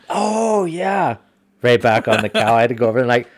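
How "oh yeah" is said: squeaky pitched-up oblivious yes; high dopey agreeable voice; high clueless client voice. Retold or imitated. Retold client misses the target.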